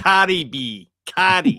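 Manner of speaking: loud mock Boston accent; mimicked Boston accent. Co-host parroting the comic's [name] line, mock Boston accent. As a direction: throw it out in an exaggerated Boston accent. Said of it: "The accents, the, that's the funny thing".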